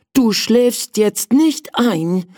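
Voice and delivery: high, slow little prince; prince talking to himself. Acted. Sleepy prince to himself, high slow voice. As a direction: slowly order yourself to stay awake. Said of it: "sagte er zu sich".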